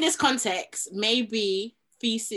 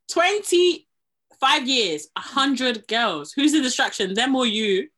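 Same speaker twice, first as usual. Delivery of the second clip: slow, loud, incredulous mock read; mocking comment voice; 6 dB louder, slower than usual. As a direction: drag out the numbers, then jab the question. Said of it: "all the comments are like".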